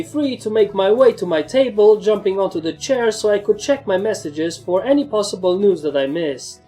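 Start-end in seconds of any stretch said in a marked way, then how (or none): none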